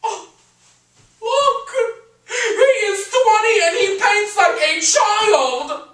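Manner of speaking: very high, loud, wailing mother; hammed-up lamenting mother; dismayed mother's exclamation. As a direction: wail it slowly in a high put-on voice. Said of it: "she just said".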